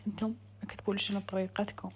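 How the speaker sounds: ordinary talk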